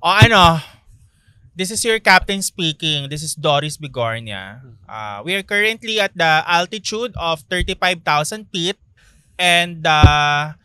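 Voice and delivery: loud pilot announcement impression; hammed-up captain over the radio; Doris Bigornia pilot voice. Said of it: "Ganito yung magiging boses niya".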